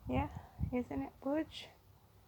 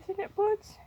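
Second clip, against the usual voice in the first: high, louder sing-song question; cooing pet talk; 9 semitones higher, 6 dB louder than usual. Real, not acted. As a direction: coo it up high to the bunny.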